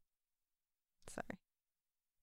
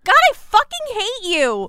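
high, loud exasperated yell; angry outburst; 9 semitones higher, 5 dB louder than usual. Acted. Player refusing to kill her, angry yell. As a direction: shout it at them, pitched way up.

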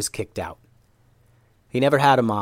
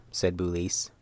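ordinary delivery

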